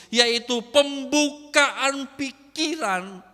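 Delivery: loud, driving sermon delivery with a punch on each kau; pounding out kalau kau like a revival preacher; full-force preaching. Acted. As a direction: preach it hard, building the rhythm.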